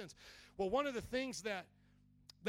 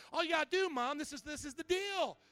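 high, insistent plea to mom; lightly voiced daughter laying out the deal; urging daughter; 7 semitones higher, 4 dB louder than usual. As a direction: press mom earnestly, pitched up. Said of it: "So she says".

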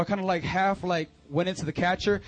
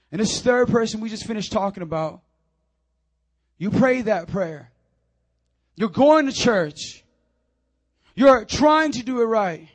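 loud, slow, punched-out phrases; emphatic preaching; 4 semitones higher, 11 dB louder than usual. Real, not acted. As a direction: drive each phrase home slowly to the congregation. Preaches on the third person.

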